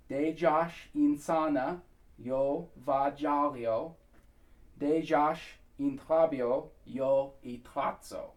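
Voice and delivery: slow, word-by-word lyric demo. Shown as a demonstration. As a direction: separate each word with small pauses, unhurried. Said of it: "slightly slower".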